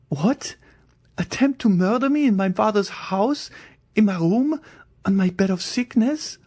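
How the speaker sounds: put-on a terrified girl refusing to believe; frightened young Valentine. A narrator's reading. Valentine pleads with the Count.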